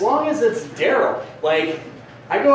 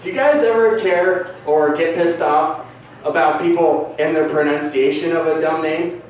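ordinary delivery

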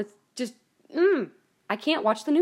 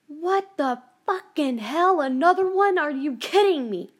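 louder, higher, slow disbelieving yell; outraged outburst; 6 semitones higher, 6 dB louder, slower than usual. Retold or imitated. Hearing news of a shooting on TV, furious disbelief. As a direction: explode at yet another shooting. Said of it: "And I went".

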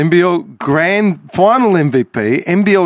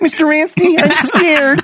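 put-on higher, timid little boy voice; scared young boy; 11 semitones higher than usual. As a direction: play a frightened kid calling his coach. Timid young player to coach.

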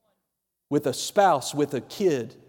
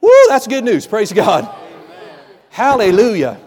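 real, louder burst of preacher's joy; whoop, then praise God emphatically; exultant praise; 6 dB louder than usual. Real, not acted. Preacher exclaims praise to congregation.

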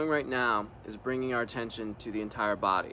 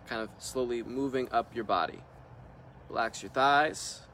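calm meditation leader leaving room to breathe; guided meditation; 5 dB quieter than usual. Real, not acted. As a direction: guide them into relaxing, unhurried, one short instruction at a time. Leads a guided relaxation meditation.

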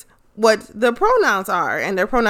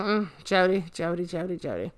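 repeating the name, fading softer and lower; knowing mutter; 3 semitones lower, 10 dB quieter, faster than usual. Real, not acted. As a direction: shake your head at the culprit. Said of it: "I'm just like".

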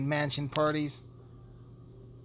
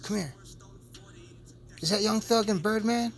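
high, urgent calls to a friend; shocked high calling; 5 semitones higher than usual. Acted. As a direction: wave your buddy over in excited disbelief.

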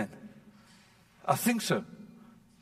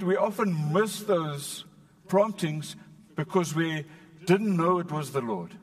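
ordinary delivery

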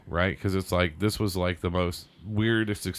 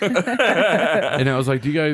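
laughing; 8 semitones higher, 6 dB louder, slower than usual. Real, not acted. Laughing while telling awkward story.

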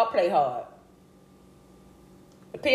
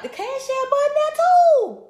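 high playful call; 4 dB louder than usual. Acted. Playful hype for donations.

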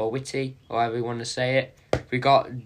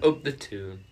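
a failed, lower Geordie "Up the tune"; a weak Geordie try; bad Geordie; 6 semitones lower than usual. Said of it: "that is abysmal".

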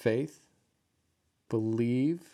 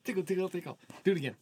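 giggly tickle attack; 7 semitones higher, much faster than usual. Real, not acted. Tickling playfully, laughter follows.

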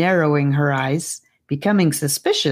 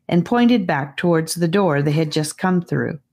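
ordinary delivery, nothing marked

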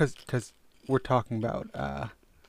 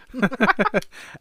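high-pitched laugh; 11 semitones higher, 6 dB louder than usual. Real, not acted. Laughs at own flub.

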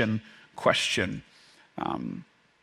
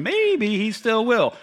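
hopeful Jonah; 8 semitones higher, slower than usual. Retold or imitated. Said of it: "Jonah is sitting here going".

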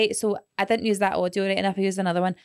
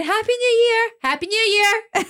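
very high, loud tune on "Happy New Year"; Ella; 14 semitones higher, 5 dB louder than usual. Retold or imitated. Imitating a friend's voice notes, very high loud "Happy New Year". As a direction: squeal "Happy New Year" far above your normal pitch. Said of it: "Ella's going".

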